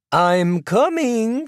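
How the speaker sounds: high, drawn-out sing-song call. A narrator's reading. Actress calls to a knock at her door, sing-song. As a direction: sing it out slowly, high and lilting. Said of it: "in her sing-song voice".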